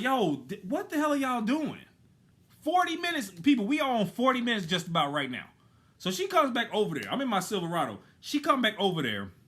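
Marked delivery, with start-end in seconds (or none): 0.0-5.5 exasperated outburst. Retold or imitated. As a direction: let the frustration spill out. Angry customer venting.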